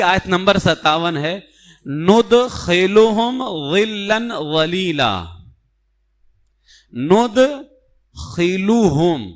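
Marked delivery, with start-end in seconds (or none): 1.9-5.2 slow, careful tajweed recitation of ندخلہم ظلا ولیلا; Quranic recitation. Shown as a demonstration. Teacher reciting the verse for students, slow and precise. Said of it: "اس کو بہت سارے پڑھتے ہیں". 7.1-9.3 ندخلہم stretched very slowly, each sound held; drawn-out recitation. Shown as a demonstration. Teacher isolating the word for students, very slow. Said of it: "اس کو بہت سارے پڑھتے ہیں ندخلہم".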